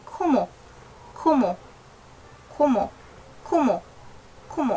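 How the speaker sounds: KUMO with lightly aspirated k, short, falling; correct Korean kumo. Shown as a demonstration. Teacher models the right word, brief KUMO with airy k, falling. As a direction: puff lightly on KU and drop on MO. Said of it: "it should be".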